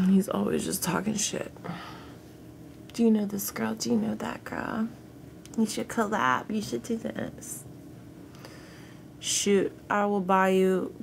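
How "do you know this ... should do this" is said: mocking imitation. Mocking a pestering viewer's questions.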